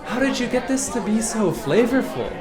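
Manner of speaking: high, gushing aunt voice, laughing. Retold or imitated. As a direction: gush like a polite aunt.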